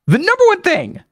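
very high, insistent build-up; emphatic hype. Real, not acted. Podcaster revealing Dublin's main draw, high emphatic hype. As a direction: pitch it high to set up the answer.

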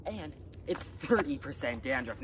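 normal talking voice

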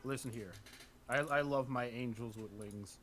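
low, quiet slurry urchin voice. Real, not acted. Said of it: "why I sound like a fucking drunken street urchin".

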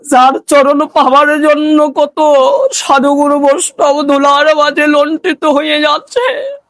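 high, impassioned devotional cry; preaching. Acted. Preacher revealing Govinda as the guard, impassioned preaching. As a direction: build to a sustained, ringing proclamation.

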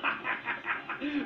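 short cocky cackle; acted mocking laugh; taunting laugh. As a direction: laugh at your own insult. Duck laughs at its own insult.

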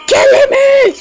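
high, loud outraged yell; loud squealing complaint; shout. Real, not acted. Character being killed.

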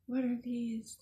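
ordinary delivery

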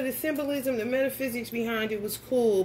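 ordinary delivery

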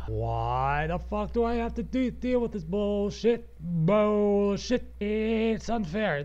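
high, stretched-out complaint; exasperated whine. Acted. Podcaster protesting wasted work, drawn-out exasperated whine. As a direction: drag out the words like a wronged kid. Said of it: "at the same time, it's like".

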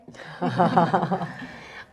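short real laugh at the question; an easy, amused chuckle. Real, not acted. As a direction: laugh it off lightly before answering. Coach amused by fan question.

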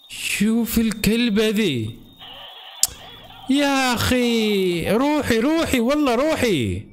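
a monster just appeared, terrified; startled and scared. Real, not acted. Gamer jolted by a scare, gasping high fright. Jump scare in horror game.

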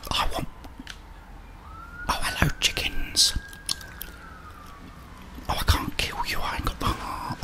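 ordinary delivery

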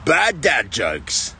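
drawn-out, deadpan intro call; slow title announcement. Acted. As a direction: announce it slowly like a show title.

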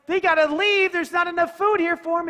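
high, loud, worried rush; anxious urgency. Acted. Peter telling Jesus the crowd must go, in a loud worried voice. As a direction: sound alarmed at the hungry crowd.

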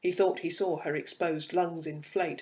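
ordinary delivery

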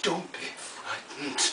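slow, hushed stage whisper; whispered reassurance. Acted. Old star soothing her little helper, slow whisper.

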